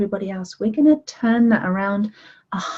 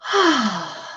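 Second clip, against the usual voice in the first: relaxed sigh. Shown as a demonstration. Settling in, leading relaxation.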